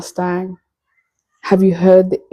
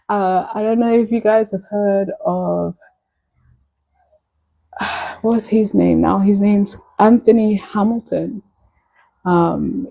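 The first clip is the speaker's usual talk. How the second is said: ordinary delivery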